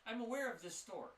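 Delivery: high, loud shopkeeper voice; eager shopkeeper. Acted. Shopkeeper reads the flyer from the warlock, high and eager. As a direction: keep them talking, glad for company.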